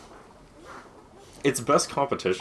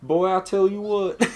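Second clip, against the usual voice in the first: hammed-up Southern accent; 11 semitones higher, 5 dB louder than usual. Shown as a demonstration. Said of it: "that and Southern, Southern accent".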